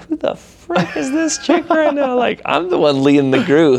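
high, incredulous mock outrage; indignant. Leader retells being grilled by the newcomer, indignant. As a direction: act out being affronted, pitch jumping up.

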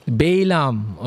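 Balaam as "BAY-lam", two syllables; alternative pronunciation. Shown as a demonstration. Preacher offering another option if you want, alternative pronunciation. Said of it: "okay, if you want".

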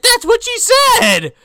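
high, loud, drawn-out comic protest; mock-indignant shouted comeback; exasperated retort. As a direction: yell back at Mom, hammed up. Son snaps back at doubting mom.

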